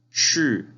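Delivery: loud, drawn-out "shi" sliding down; falling tone. Shown as a demonstration. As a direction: say "shi" with a sharp downward tone. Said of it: "el último tono hacia abajo".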